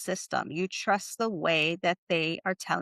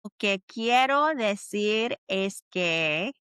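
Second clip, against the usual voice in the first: slow, careful model phrase for learners to echo; slow teacher modelling; slow repeat-after-me; slower than usual. Shown as a demonstration. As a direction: spell out "Lo que quiero decir es que" slowly. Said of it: "Say it with me".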